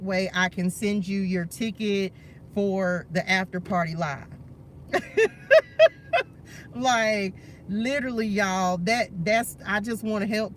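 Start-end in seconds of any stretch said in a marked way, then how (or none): 4.3-6.7 much higher, louder delighted laugh; high shrieking laugh of delight; giddy squealing laugh. Real, not acted. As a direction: squeal with joy. Overjoyed at a donation.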